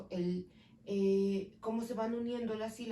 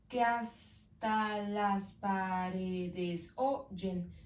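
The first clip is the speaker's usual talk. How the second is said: very slow syllable count; 3 semitones lower, much slower than usual. Shown as a demonstration. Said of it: "Tengo ocho".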